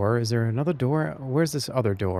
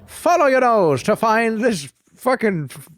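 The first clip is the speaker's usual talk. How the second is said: much higher, slow, louder cartoon-mascot sing-song; Toucan Sam; 8 semitones higher, 3 dB louder, slower than usual. Streamer imitates the cereal mascot for chat, hammed-up higher and slower. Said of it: "Yeah, Toucan Sam".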